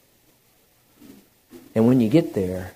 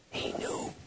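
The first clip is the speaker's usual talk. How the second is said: barely audible, breath-only whisper; awed whisper; 16 dB quieter than usual. Real, not acted. Preacher revealing what Jesus knew, awed whisper. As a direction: whisper it like a revelation. Hushed revelation, then voiced.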